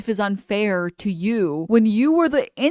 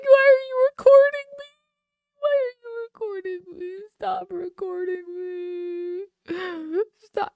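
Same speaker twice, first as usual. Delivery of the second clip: mock crying; 13 semitones higher than usual. Streamer imitating a woman crying at being recorded, mock crying. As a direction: imitate a tearful, hammed-up whine.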